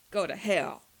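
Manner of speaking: loud, spat-out snarl; snarling defiance. A narrator's reading. Defiant prisoner snarls at his interrogators, loud angry snarl. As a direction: throw the curse in their faces. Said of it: "Tim snarled".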